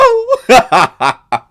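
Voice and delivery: loud high laugh. Real, not acted. Host laughing after the quote, bursting laugh. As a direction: laugh at yourself. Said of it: "laughs after quote".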